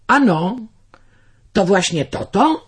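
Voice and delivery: rubaszny woźnica, wyżej i głośniej; wiejski woźnica. A narrator's reading. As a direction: prostacki furman z nutą kpiny. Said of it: "odparł woźnica i roześmiał się". (lekko zagrany woźnica z kpiną)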